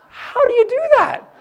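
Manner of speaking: high, loud, incredulous squeal; amazed. Retold or imitated. Husband asking his quick-rising wife, high amazed squeal. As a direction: marvel at her like it's a miracle. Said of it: "I'm like".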